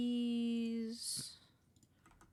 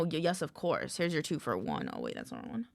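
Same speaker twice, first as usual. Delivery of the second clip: ordinary talk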